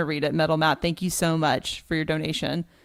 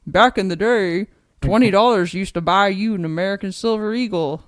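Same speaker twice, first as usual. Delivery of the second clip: lightly voiced old man reading, louder; 3 semitones higher, 5 dB louder than usual. Shown as a demonstration. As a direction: read it like a creaky old man. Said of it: "that was my best old man voice impression".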